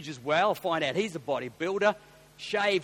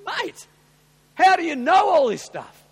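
amazed question; 13 semitones higher, 13 dB louder than usual. Retold or imitated. Pastor's amazed question to owner.

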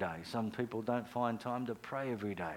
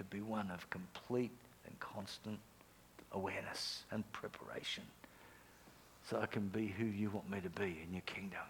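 softer whispered prayer to God; hushed prayer; 4 semitones lower, 9 dB quieter than usual. Shown as a demonstration. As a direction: pray it quietly, alone with God. Modelling a private prayer.

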